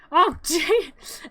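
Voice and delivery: laughing, frustrated. Real, not acted. Laughing at game.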